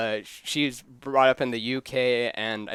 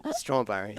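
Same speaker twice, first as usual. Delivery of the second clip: STRAWBERRIES lower, falling like a statement; no question lift; 4 semitones lower than usual. Shown as a demonstration. Host retrying British without the question, Strawberries falling at the end. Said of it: "Try to move the question part out of it".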